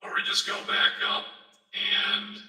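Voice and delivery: whispery voice changer effect; filtered whisper voice. Shown as a demonstration. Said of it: "put the whisper filter on".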